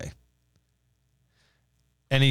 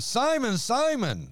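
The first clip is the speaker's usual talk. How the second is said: Simon, Simon called out much higher, urgently; Simon, Simon rising high with urgency; emphatic call; 11 semitones higher, slower than usual. Shown as a demonstration. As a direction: call his name like an exclamation point. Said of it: "emphatic because there's an exclamation point".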